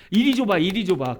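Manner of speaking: loud, mildly acted call to hand the baby over; eager relative. Relative asking to hold someone else's baby, eager and loud.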